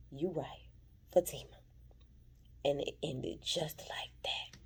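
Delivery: whispered slow cliffhanger; slow hushed reveal; dramatic whisper. Real, not acted. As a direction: let it hang, hushed. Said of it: "dramatic cliffhanger".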